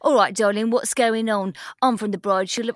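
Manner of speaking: hammed-up Cockney greeting; Cockney accent. Shown as a demonstration. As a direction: play it as a matey Cockney, accent laid on thick. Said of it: "Can you do Cockney?".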